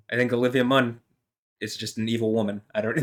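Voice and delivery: usual speaking voice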